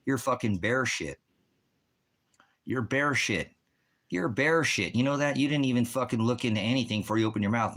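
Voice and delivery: high-pitched, heated insults; real angry rant. Real, not acted. Streamer cursing out a chat commenter, angry.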